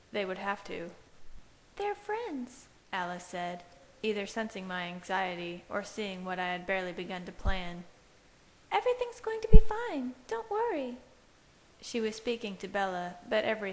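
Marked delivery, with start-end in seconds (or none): 1.8-2.7 put-on much higher light girl's voice; Alice's voice. A narrator's reading. 8.7-11.0 put-on much higher, louder reassuring girl's voice; calm her friend from up high; Alice reassuring. A narrator's reading.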